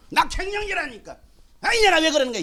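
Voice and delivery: high-pitched mock-irritated grumble; acted irritation. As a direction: play a wife fed up with the family. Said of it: "남편한테만 소리 지르고".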